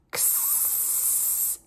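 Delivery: "ks" as a k then a long hiss; voiceless "ksssss", drawn out; stretched-out ks. Shown as a demonstration. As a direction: click the k and hold the s in "ks". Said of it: "another stretch out sound".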